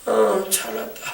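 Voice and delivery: slow sleepy sigh of giving in; mock drowsy drawl; acted dozing off. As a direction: drag it out as if dropping off to sleep.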